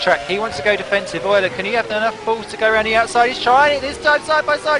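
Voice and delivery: rising race call, voice climbing high; excited commentary. Real, not acted. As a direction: call the overtake live, building excitement.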